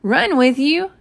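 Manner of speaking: astonished, pitch leaping up; surprised question. A narrator's reading. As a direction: echo it back in disbelief. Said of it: "I was so surprised".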